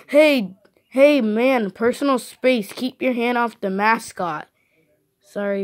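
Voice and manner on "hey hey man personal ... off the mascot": hey, back off, in a loud outraged voice; loud protest. Acted. Character protests being touched.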